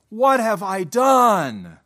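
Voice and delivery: loud, slow cry with DONE drawn out and falling; emphatic inflection. Shown as a demonstration. Preacher voices the repentant man's question, emphatic, DONE loud and drawn out. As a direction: stretch DONE long and hit it hard. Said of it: "with a better inflection".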